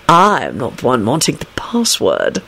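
slow, low, disdainful drawl. A narrator's reading. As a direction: drawl it out with contempt. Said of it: "Snape drawled out disdainfully".

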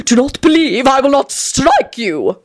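high, slow, swooning vampire voice; rapturous. Acted. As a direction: gush it out in raptures. Tony threatens.

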